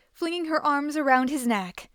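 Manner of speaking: ordinary delivery, nothing marked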